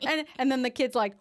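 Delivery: ordinary talk, nothing marked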